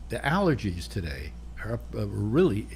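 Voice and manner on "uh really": ordinary delivery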